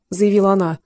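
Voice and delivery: ordinary delivery, nothing marked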